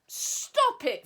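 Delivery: high, loud squeal. Acted. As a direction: squeal in protest. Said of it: "Figgy squealed".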